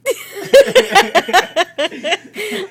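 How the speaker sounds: real loud, high belly laugh; cracking up. Real, not acted. Listener losing it at the dog act, loud real laughter. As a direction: burst out in uncontrollable cackles. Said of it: "I can't stop cracking up".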